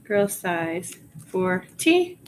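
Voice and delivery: ordinary delivery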